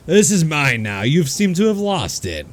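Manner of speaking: smug official voice; a hint of a gloating town leader; speaker of East Haven. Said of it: "He looks over and he goes".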